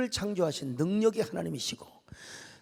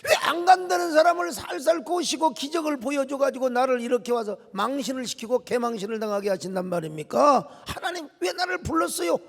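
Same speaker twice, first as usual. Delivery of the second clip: protesting; 2 semitones higher than usual. Acted. Moses blaming God for sending him, exasperated protest. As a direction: plead and argue with raised voice. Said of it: "이렇게 항변을 했어요".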